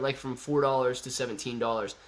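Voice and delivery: normal talking voice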